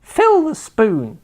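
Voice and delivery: slow, high grandma voice; a slow, high old woman's order. A narrator's reading. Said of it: "the old woman said".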